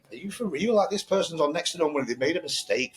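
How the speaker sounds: high-pitched incredulous outrage; shocked, high indignant rant at a rude customer; indignant disbelief. Retold or imitated. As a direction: pitch it way up, like you can't believe what you're hearing. Indignant retold reaction to rude customer.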